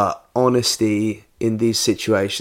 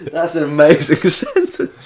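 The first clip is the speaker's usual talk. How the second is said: high, loud words through laughter; laughing; 5 semitones higher, 6 dB louder than usual. Real, not acted. Reacting to an amazing sentence, laughing. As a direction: talk while cracking up. Cracks up at the phrase.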